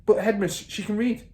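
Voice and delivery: put-on high, insistent young teacher; teacher pressing her case; eager protest. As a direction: press the point. Miss Honey voiced.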